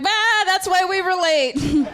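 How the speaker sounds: high, slow laughing voice; laughing girlfriend. Retold or imitated. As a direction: mimic her amused reply. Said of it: "She was like".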